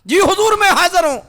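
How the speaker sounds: high, loud answer to a summons; shouted reply. Acted. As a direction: report present at the top of the voice. Suraqa answering the caliph's call. (بلند آواز میں جواب)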